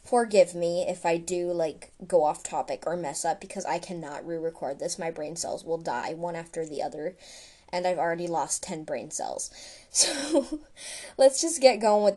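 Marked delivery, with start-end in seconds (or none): none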